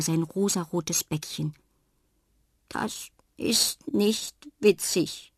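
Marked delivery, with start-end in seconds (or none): 2.7-5.3 slow tearful clown echo; slow sad repeat. A narrator's reading. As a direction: repeat her words slowly and sadly. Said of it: "wiederholt er langsam". (langsam und traurig wiederholt)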